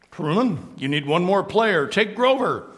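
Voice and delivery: firm but kind teacher. Acted. Teacher assigning Grover to Truman's team, lightly voiced. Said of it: "letting me do the voices".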